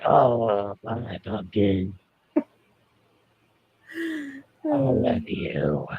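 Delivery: slow doting croon in a put-on voice; sweet crotchety pet. Owner voices a doting pet, slow and sweet. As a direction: draw out the endearment in a pet's voice. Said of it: "She's really sweet. She's like".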